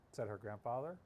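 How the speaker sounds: plain reading voice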